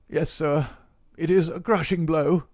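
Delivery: put-on high, loud lament from an elderly scholar; high-pitched, emphatic old professor. A narrator's reading.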